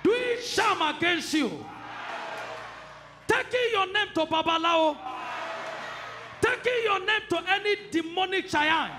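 preaching at full force; fiery preaching. Acted. Deliverance prayer shouted over congregation.

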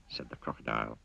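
usual speaking voice

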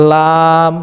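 লাম with tongue tip on upper gums, held one alif; Arabic letter lam. Shown as a demonstration. Teacher demonstrating লাম to students, drawn out. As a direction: chant লাম with a long pull. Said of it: "এক আলিফ পরিমাণ টান দেবেন".

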